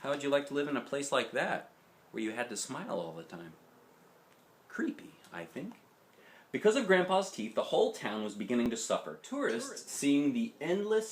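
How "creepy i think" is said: whispered aside. Real, not acted. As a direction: lean in and whisper it. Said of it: "aside comment, whispered".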